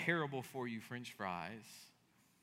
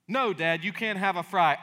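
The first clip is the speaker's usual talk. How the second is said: louder playful no; mock bratty child; 4 semitones higher, 11 dB louder than usual. Child refusing dad a fry, hammed-up loud. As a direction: ham up a kid refusing.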